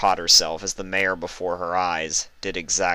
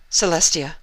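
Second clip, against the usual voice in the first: tearful recognition; 10 semitones higher, 4 dB louder than usual. Acted. Luna recognizes her sister.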